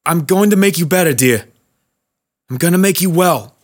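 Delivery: put-on old man promising his wife through tears; tearful promise. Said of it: "tears welling in his eyes".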